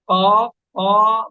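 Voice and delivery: क़ाफ, क़ा said slowly with a crisp bounce on क़ाफ; slow teacher demo of क़ाफ, क़ा; qalqala letter demo. Shown as a demonstration. Teacher to student, drawn out and slow. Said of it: "इनके पढ़ने का तरीका ये है".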